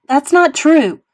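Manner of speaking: high, loud denial; yelled. A narrator's reading. Mother protesting to her son, yelling. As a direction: yell it back in protest. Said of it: "Mom yelled".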